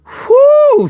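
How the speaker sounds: high piercing wrestling-style whoo; excited whoo yell. Retold or imitated. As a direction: let out a wild ring whoo. Wrestling hype shout, Ric Flair style.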